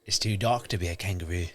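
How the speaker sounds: put-on a light, quick go at an Australian accent; imitating Australian accent. Host copying the Australian ranger from the clip, light Australian accent. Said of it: "I can't do the accent that well".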